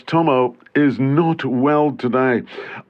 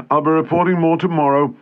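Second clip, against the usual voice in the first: normal talking voice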